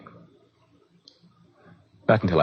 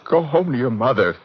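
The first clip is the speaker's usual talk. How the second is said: raised, higher-pitched command; upset dismissal; 6 semitones higher, 4 dB louder than usual. Acted. Patient ordering crying girlfriend to leave, upset and forceful. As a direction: send her away, voice rising in distress.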